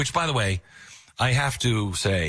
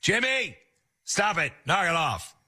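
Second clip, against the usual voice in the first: high, scolding mother; a hint of a stern mom; 8 semitones higher than usual. Mom scolding Jimmy in story.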